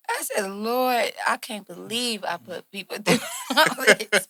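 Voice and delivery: real laughter through the words; giggling, amused at herself over all those takes; laughing. Real, not acted. Laughing at her own recordings.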